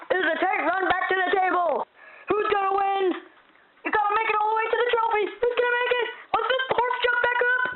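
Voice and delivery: high, frantic announcer yelling; excited play-by-play. Acted. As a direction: lose it as the race finishes. Race commentary climax.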